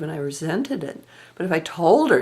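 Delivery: ordinary talk, nothing marked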